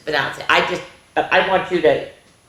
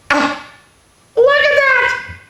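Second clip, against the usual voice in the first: much higher, slow, louder amazed look at that; 4 dB louder, slower than usual. Acted. Teacher marvels at the page for the children. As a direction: marvel at it for the kids.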